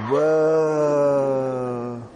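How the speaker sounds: long low stoned-out "Whoa"; drawn-out mellow surfer "Whoa". Retold or imitated. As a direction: stretch it like a dazed surfer. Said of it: "then you're like".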